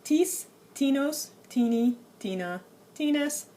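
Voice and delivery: tis, tinos, tini, tina, tines read out slowly and carefully as a demo; Greek paradigm recited. Shown as a demonstration. Teacher reciting the Greek forms to students, carefully. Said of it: "the Greek forms ... are".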